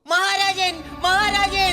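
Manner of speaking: high, loud cry for the king; alarmed calling out. Acted. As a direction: call out urgently with bad news. Servant rushes in with fire alarm.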